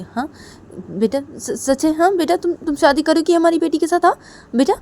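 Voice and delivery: stammer, then burst into eager questions; astonished delight. Acted. Father astonished by marriage news. (हैरानी भरी खुशी)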